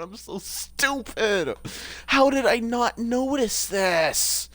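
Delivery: slow, drawn-out whine; exasperated self-reproach. Real, not acted. Gamer kicking themself over a missed detail, drawn-out exasperated whine. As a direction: stretch it out in dismay at yourself.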